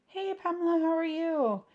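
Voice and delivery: high, chirpy hello to a viewer; bright greeting. Real, not acted. Vlogger greets a viewer in chat, high chirpy hello. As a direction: lift the voice to greet a chat friend.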